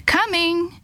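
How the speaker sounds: high, sung-out reply; calling back. A narrator's reading. Maria answers her friend's call, calling back.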